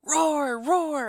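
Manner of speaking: put-on little boy's high playful roar; playful dragon roar. A narrator's reading. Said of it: "Suddenly he cried".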